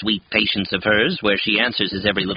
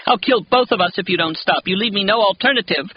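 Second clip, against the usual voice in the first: madwoman yelling; 11 semitones higher than usual. A narrator's reading. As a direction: rave like a mother at the end of her rope. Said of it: "yelling madwoman things like".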